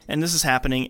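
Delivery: ordinary delivery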